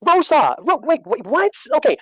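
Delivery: high, flustered and stunned; can't believe what you read; stunned disbelief. Real, not acted.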